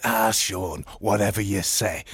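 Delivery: low, soft, submissive reply; timid, placating murmur; cowed. Acted. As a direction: give in meekly to a hard man. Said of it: "he's shaking".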